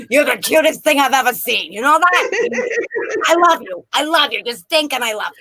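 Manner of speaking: put-on light baby talk to an infant; a touch of cooing at the baby. Mom talking to her baby son in mild Brooklyn baby talk. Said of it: "I talked to him like that when he was a baby".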